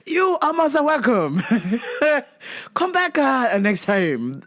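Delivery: giggly, polite mock Japanese accent; see them off in bad Japanese-accented English; terrible Japanese accent. Clerk seeing customer off, terrible Japanese accent. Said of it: "A terrible Japanese accent".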